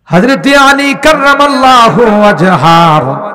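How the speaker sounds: high, loud ringing proclamation; full-force preaching. Real, not acted. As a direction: launch back into the sermon at full voice. (জোর গলায় উঁচু সুরে ওয়াজ)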